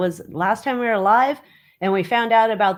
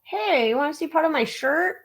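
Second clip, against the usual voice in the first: higher, put-on cheeky voice; voicing the camera; 8 semitones higher than usual. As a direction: play the camera piping up with an offer. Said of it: "It's all".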